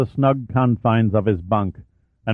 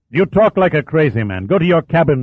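louder, higher angry dismissal; angry order; 6 semitones higher, 4 dB louder than usual. Acted. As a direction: order him out furiously. Captain orders officer away.

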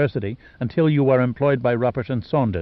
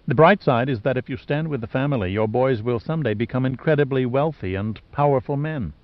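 ordinary delivery, nothing marked

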